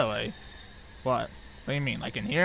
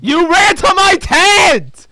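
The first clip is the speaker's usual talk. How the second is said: angry slow shout; 5 semitones higher, 3 dB louder, slower than usual. Real, not acted. Player shouting at Michael who led the ghost in, angry. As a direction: yell each word, slow and higher.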